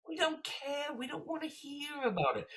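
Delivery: very high-pitched mock yelling; strained high protest, hammed up; exasperated heckler. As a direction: imitate someone wailing in exasperation. Imitating fed-up heckler.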